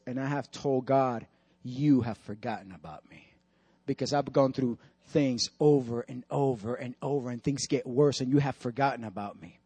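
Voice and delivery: low, soft, heavy-hearted retelling; retold tearful complaint. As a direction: voice a broken man's grievance quietly. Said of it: "and he starts crying".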